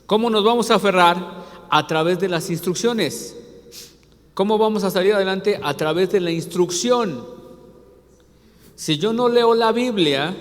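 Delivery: loud, fervent preacher calling out; preaching. Acted. Pastor preaching to his church, loud and fervent. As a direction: drive it home to the congregation. Preaches to congregation at full force.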